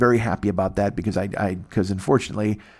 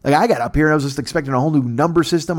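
ordinary delivery

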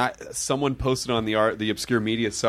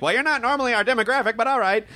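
higher-pitched imitation of a TV host; imitated show host; 9 semitones higher, faster than usual. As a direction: quote the host in a put-on higher voice. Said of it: "and the guy's like".

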